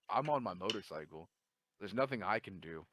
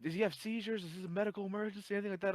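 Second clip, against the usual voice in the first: panicked bystanders; 12 semitones higher, 4 dB louder, faster than usual. Retold or imitated.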